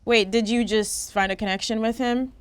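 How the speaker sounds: normal talking voice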